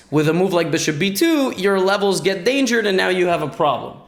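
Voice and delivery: ordinary talk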